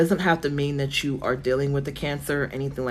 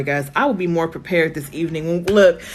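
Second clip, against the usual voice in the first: ordinary talk, nothing marked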